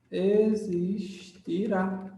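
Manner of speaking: very slow, stretched 'existirá'; slow dictation. Real, not acted. Teacher completing the rule for students, slowly drawn out. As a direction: draw the word out as if dictating.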